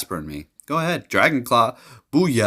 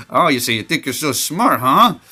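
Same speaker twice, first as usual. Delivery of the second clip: mock taunt; 5 semitones higher, 5 dB louder than usual. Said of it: "I say that sometimes".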